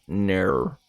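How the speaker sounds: Australian "ner", vowel drawn into an r-coloured "air"; Australian accent. Shown as a demonstration. Streamer demonstrating an Australian no for chat, Australian accent. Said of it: "favorite thing about the Australian accent".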